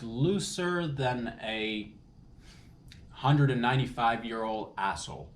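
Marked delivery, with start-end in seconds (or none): 0.0-1.9 very slow, stretched-out words; drawn-out comic setup. Real, not acted. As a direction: drag it out to tee up the punchline.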